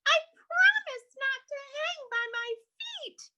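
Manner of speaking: high, plaintive little bat voice; plaintive sigh. Acted. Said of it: "Stella Luna sighed".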